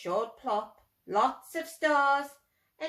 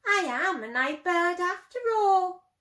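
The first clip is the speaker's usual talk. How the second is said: proud; 6 semitones higher, 5 dB louder than usual. Acted. Plop proudly declares.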